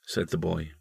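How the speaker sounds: usual speaking voice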